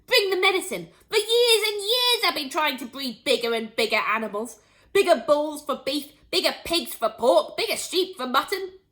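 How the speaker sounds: loud, high, carried away with a big idea; urgent, excited shouting; excited yelling. A narrator's reading. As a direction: yell it out like a farmer seized by ambition. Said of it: "Mister Cranky yelled".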